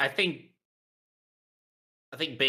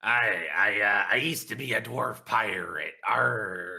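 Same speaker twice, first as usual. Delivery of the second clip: lightly voiced dwarf pirate, a bit loud; 2 semitones higher, 5 dB louder, slower than usual. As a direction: slip from dwarf into a pirate growl. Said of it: "riding the pirate voice versus the dwarf voice".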